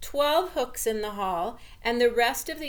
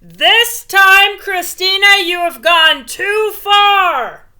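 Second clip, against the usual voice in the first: much higher, very loud, slow furious shout; high loud enraged dad yell; father shouting; 10 semitones higher, 17 dB louder, slower than usual. A narrator's reading. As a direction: bellow it like a fed-up father at the end of his rope.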